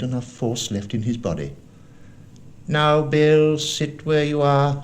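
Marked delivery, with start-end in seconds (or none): 2.7-4.8 high, loud command, slowing at the end; cruel blind beggar. A narrator's reading. Blind beggar to the sick captain, high commanding voice. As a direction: order him to stay put, pitched up.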